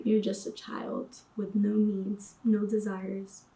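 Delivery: ordinary delivery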